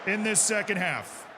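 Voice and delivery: rally the players, raise your voice; halftime pep speech. Acted. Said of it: "halftime team talk to players".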